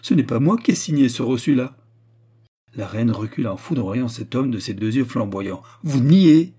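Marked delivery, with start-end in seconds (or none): 0.0-1.8 loud, agitated protest, raised in pitch; rage and fright. A narrator's reading. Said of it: "en étranglant de rage et de frayeur tout ensemble". 5.8-6.5 loud, high burst of disbelief; outraged challenge. A narrator's reading. Queen recoils, eyes blazing, challenges him.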